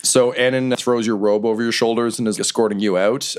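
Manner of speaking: ordinary talk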